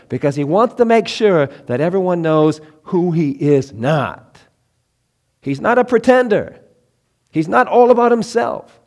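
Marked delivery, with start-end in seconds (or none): 5.4-8.8 high, loud insistence; a raised fervent insistence; emphatic preaching. Acted. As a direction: drive the point home.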